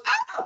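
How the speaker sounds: a very high squeaked "Ah!" of horror; chimp's shriek. Retold or imitated. Inner chimp seeing the messy kitchen, squealing high. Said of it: "And my chimp goes".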